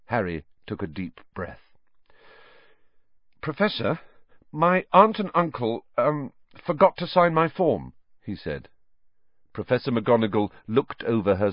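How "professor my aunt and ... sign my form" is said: lightly hesitant, higher-pitched request; nervous schoolboy. A narrator's reading. Harry at McGonagall's desk, a touch nervous. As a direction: pick your way through the excuse, higher.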